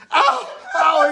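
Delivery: very high, loud hammed-up oh of pain; mock pained cries. Drunk man cries out as the leprechaun gets him. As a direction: wail it out, laughing through it.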